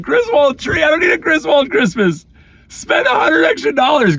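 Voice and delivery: incredulous protest. Retold or imitated. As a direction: scoff at the cheap tree. Arguing with wife over tree.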